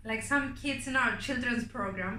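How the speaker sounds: usual speaking voice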